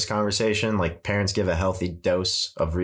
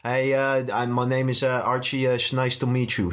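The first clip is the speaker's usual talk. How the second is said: a hint of a Dutch accent, pitched up; 5 semitones higher than usual. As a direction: play Archie with a light Dutch accent. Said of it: "Should use the Dutch accent".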